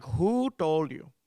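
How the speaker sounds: slow, accusing mock interrogation; stretched WHO in an Indian accent; mad mom. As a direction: demand it as an angry Indian mother. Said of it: "never seen her this mad before".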